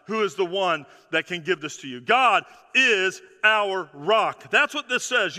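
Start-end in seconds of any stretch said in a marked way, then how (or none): 2.0-4.5 slow emphatic declaration. Acted. Preacher's key declaration.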